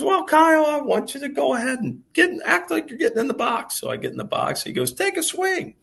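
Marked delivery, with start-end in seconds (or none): 0.0-3.8 much higher, hammed-up mentor voice; Ken Ravizza's voice. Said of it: "Ken's got this great voice". 4.9-5.7 much higher, hammed-up mentor voice; Ken Ravizza's voice. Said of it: "Ken's got this great voice".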